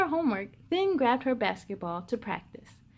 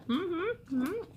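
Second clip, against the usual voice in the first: humming through a mouthful; mm-hmm with a mouth full of pancakes; 5 semitones higher, 6 dB quieter than usual. Acted. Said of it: "huge bite of her pancakes in her mouth".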